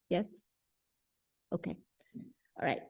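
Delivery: ordinary talk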